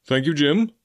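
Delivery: a touch loud, for show; quite loudly. Acted. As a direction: say it up for others to hear. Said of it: "said he quite loudly".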